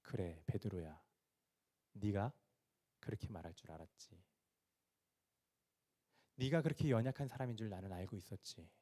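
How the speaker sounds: low, soft, understanding voice; compassionate. Acted. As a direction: speak gently to a disciple who failed you. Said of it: "치근하게 바라보시는 거예요". (측은하게, 낮고 부드럽게)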